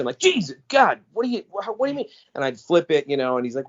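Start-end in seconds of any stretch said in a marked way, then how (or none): 0.2-2.1 high, jumpy, caught-off-guard gasp of words; like a kid caught red-handed; startled panic. Retold or imitated. Startled by brother at door.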